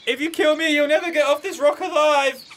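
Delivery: high, loud, desperate cry; panicked warning. Acted. Newcomer about to be eaten, panicked high shout. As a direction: yell it as a frantic plea for your life. Threatened man pleads for life.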